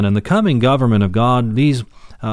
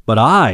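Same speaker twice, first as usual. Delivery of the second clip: "I" hit louder than the words round it; emphatic stress on I; 5 dB louder than usual. Shown as a demonstration. Preacher stressing the Greek emphasis for listeners, emphatic "I". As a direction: lean hard on "I". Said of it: "emphatic, this is emphatic".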